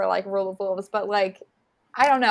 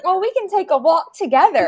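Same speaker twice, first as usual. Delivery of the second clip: innocent quote; 7 semitones higher, 6 dB louder than usual. Retold or imitated. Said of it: "she was like".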